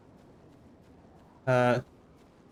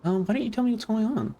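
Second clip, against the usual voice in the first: high, quick customer service voice; friendly help desk agent, brisk and bright; tech support voice; 9 semitones higher, faster than usual. Pretend. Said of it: "my tech support voice".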